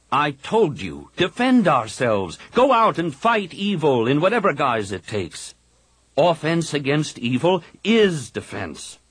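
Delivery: Kip's fervent voice. A narrator's reading. Kip urging the Jedi council to fight, insistent. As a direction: preach the fight, every word pressed.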